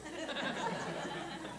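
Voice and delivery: high airy giggle; real silent laugh at the punchline; breathy laugh. Real, not acted. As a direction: soundless wheeze of a laugh. Laughing at his own joke.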